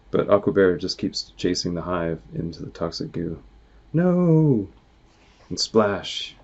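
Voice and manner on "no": much higher dismayed yelp; horrified cry. Acted. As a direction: cry out in alarm. Bear dives into toxic goo.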